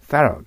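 "thorough" said as two short beats, "tha-ra"; thorough as "sara". Shown as a demonstration. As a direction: stress the first syllable of "thorough", ending in a short "ra". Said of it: "thoroughと発音するような感じがいいです". (thorough を「サラ」のように発音)

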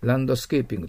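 ランドスケーピング in flat Japanese syllables, "do" sounded; quick ランドスケーピング with every kana; katakana pronunciation. Shown as a demonstration. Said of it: "わからないと思いますよ".

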